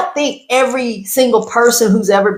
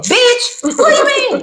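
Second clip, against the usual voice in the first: sudden high-pitched indignant retort; hammed-up outrage; carried-away outburst. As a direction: shoot back, voice leaping way up. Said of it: "got a little carried away".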